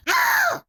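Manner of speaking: high, loud scream; short terrified shriek; Tsukasa. A narrator's reading. Tsukasa flees the talking plushie, screaming.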